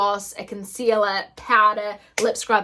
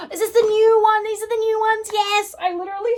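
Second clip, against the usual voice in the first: excited squeal; 10 semitones higher, 3 dB louder than usual. Real, not acted. Gasps at new makeup item.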